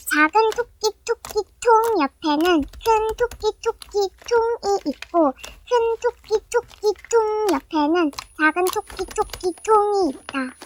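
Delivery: slow, careful tongue twister. Acted. Careful tongue-twister drill, said slowly. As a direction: spell out each syllable slowly.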